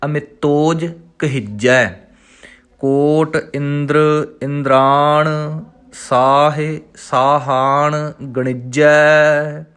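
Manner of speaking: slow, measured recital of the verse; slow devotional reading; scripture recitation. Shown as a demonstration. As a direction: recite each word slowly and clearly. Chants the scripture line.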